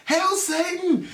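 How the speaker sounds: high, drawn-out metal-fan cry; mock crowd yell. Imitating somebody between show changes, mock yell. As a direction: imitate a yell without full volume.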